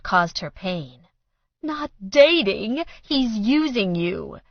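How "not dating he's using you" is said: high, loud, slow accusation; accusing. A narrator's reading. Mother accusing her daughter, high and slow. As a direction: spit the accusation. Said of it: "she accused".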